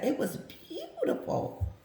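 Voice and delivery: high, breathy laughing words. Real, not acted. Laughing to viewers about the interview. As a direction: laugh it out in delight.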